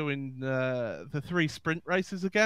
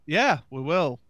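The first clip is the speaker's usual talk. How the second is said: usual speaking voice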